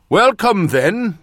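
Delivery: high, loud northern watchman's welcome; old watchman. A narrator's reading. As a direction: welcome them as a hearty countryman. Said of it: "the watchman said".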